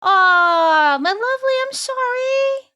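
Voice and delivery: high, slow sympathetic coo; cute anime streamer. Real, not acted. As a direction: coo it like comforting a pet. Sympathy to viewer.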